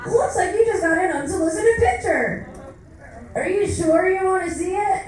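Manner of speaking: high, chirpy app announcer voice; cutesy app voice. Retold or imitated. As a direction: sing it like a cutesy app warning. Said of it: "And Bumble goes".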